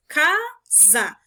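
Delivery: CASA in two separate beats, CA then SA; CA, pause, SA, firmly separated; split into syllables. Shown as a demonstration. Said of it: "Elas são duas sílabas".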